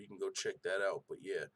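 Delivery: ordinary talk, nothing marked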